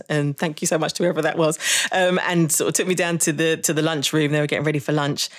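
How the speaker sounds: ordinary talk, nothing marked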